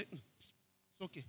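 drowsy whispered mumble; sleepy murmur. Retold or imitated. Husband half asleep murmurs to his wife.

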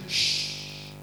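breathy unvoiced hush for silence; whispered shh to quiet someone. The Lord hushing a complaining man, whispered. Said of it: "The Lord say".

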